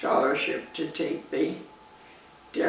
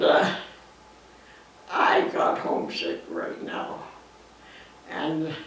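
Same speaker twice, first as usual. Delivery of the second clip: normal talking voice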